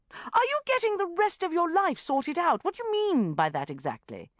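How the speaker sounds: loud, high, outraged echo of his question; indignant challenge. A narrator's reading. Sharon confronting the banker over his remark, indignant. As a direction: fling his words back at him.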